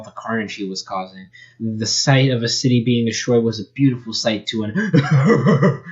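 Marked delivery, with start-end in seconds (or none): 4.6-5.9 narration through an evil laugh; reading with an evil chuckle. Acted. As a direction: laugh wickedly through the words.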